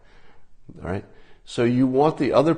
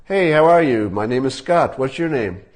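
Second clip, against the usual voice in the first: confident louder greeting; 3 semitones higher, 4 dB louder than usual. Shown as a demonstration. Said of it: "you speak louder and you command the room".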